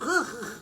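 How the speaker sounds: short, high, loud imitated laugh; annoying laugh. Shown as a demonstration. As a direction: let out a sudden obnoxious burst of laughter. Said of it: "that's his laugh".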